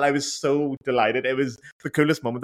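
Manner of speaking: ordinary talk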